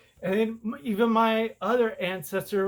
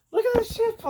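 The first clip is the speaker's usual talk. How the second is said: pitched way up and louder, fed-up disbelief; exasperated outburst; 4 dB louder, faster than usual. Real, not acted. Vlogger showing viewers a confusing DNA chart, exasperated outburst. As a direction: throw it at the viewer like you can't believe it.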